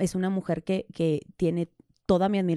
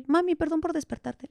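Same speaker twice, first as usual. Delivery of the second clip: a lightly voiced small girl, pitched up; mother quoting her daughter's sleepy apology; imitating her little daughter; 8 semitones higher than usual.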